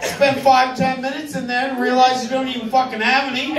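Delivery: normal talking voice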